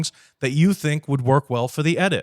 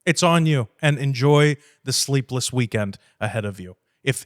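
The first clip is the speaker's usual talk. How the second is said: ordinary talk, nothing marked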